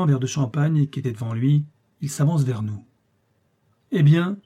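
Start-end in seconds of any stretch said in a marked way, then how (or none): 3.9-4.4 a lightly voiced foreign officer, raised and sharp; mocking officer. A narrator's reading. Officer confronting the father, lightly voiced and challenging. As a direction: challenge them with a cool, raised voice. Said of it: "avec un assez pur accent".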